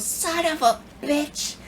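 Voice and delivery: very high, drawn-out mock cursing; cussing old lady. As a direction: imitate a little old lady swearing slowly in a squeaky-high voice. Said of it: "she starts cussing like a sailor".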